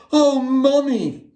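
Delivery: put-on high, eager little girl; a child pleading with her mother. A narrator's reading. Little girl begging her mother for the bear. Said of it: "she said".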